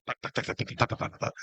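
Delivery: tá tá sussurrado imitando pingos; faça os pingos grossos caindo no ônibus; gotas de chuva imitadas. Retold or imitated. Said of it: "os gotão". (imitação sussurrada de gotas de chuva)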